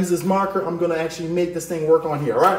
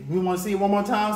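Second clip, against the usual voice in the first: ordinary talk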